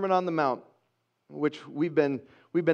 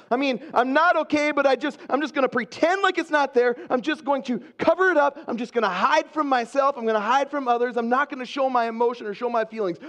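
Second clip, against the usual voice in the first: higher-pitched, pressing inner monologue; insistent self-denial; 6 semitones higher than usual. Acted. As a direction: talk yourself into covering it up. Acted self-talk of hiding feelings.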